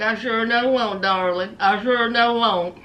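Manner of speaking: low mumbled sweet-talk; mush-mouthed Blaine. Retold or imitated. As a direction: promise it in a low, mushy mumble. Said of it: "sounding like somebody from the Fat Albert gang".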